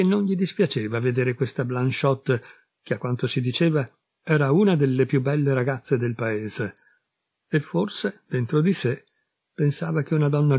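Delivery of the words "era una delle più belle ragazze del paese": plain reading voice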